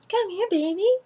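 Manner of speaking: high cooing pet voice. Real, not acted. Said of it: "I talk to my cats like they're children".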